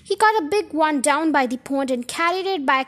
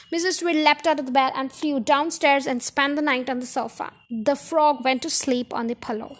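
ordinary delivery, nothing marked

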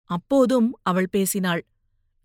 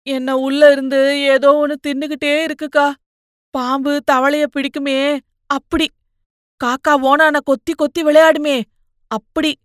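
higher, louder, haunted pleading; tormented brother; 5 semitones higher, 6 dB louder than usual. Acted. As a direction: describe the thing gnawing inside you. Brother describes being eaten inside. (அக்காவிடம் பயத்துடன் புலம்பும் தம்பி)

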